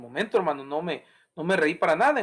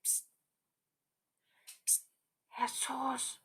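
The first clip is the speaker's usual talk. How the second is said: timid hushed psst to wake him; gentle whisper; 8 semitones higher, 16 dB quieter, much slower than usual. Retold or imitated. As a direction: whisper softly so as not to disturb. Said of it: "no me imagino a ninguno de los discípulos".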